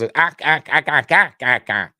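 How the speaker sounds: loud, staccato Martian squawking; Martian ack-ack. Retold or imitated. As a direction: rattle off the acks like a movie Martian. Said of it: "so just read it all like that".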